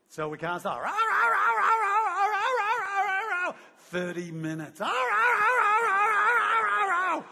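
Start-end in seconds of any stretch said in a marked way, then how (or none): 0.8-3.5 high, loud barking noises; dog barking. Retold or imitated. Dog barking to get its food, high and loud. 4.8-7.2 high, loud barking noises; dog barking. Retold or imitated. Dog barking to get its food, high and loud.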